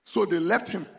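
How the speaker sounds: ordinary talk, nothing marked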